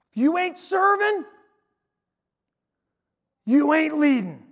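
drawn-out, high-pitched proclamation; slow, emphatic mimicked pronouncement; Larry. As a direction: imitate a kid declaring it slowly from the stage. Acts out Larry's proclamation on stage.

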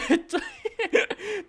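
high squeaky giggle; real high laugh, cracking up. Real, not acted. Fails to climb, cracks up.

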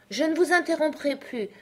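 high, meek promise; humble. A narrator's reading. Alice promising not to interrupt, humble. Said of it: "se faisant humble".

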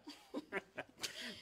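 quiet unvoiced chuckle; soft breathy laugh. Real, not acted. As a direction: laugh under your breath. Laughing at girlfriend joke.

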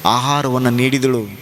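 low, measured tale of loss; solemn storytelling. A narrator's reading. Preacher telling of a mother seeing her dead son, solemn. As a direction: tell it low, with long pauses.

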